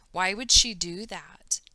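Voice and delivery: plain reading voice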